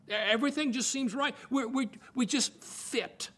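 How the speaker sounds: acted lovestruck delight, pitched high; smitten gushing. Couple falling in love over matching traits, smitten gushing. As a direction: gush like a new couple, voice lifted well up.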